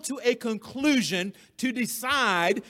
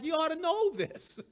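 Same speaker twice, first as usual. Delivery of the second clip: higher, lightly voiced Paul, as if it were obvious; matter-of-fact Paul; 7 semitones higher than usual. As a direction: tease it like everyone should know. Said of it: "Paul says it like".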